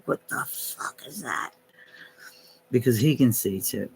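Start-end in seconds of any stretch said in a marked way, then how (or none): 0.1-1.5 hushed, breathy aside; a retold whispered curse. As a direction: whisper it in disbelief. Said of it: "He's like".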